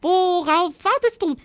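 very high, squawky little dragon urging; urging squawk. A narrator's reading. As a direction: hurry him along in a tiny squawk. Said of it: "drängte Roderich".

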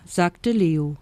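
ordinary delivery

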